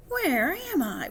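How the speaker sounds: high, drawn-out puzzled question; wondering aloud. A narrator's reading. Kitten arrives at the world's end, wondering aloud. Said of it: "wondered Skipito aloud".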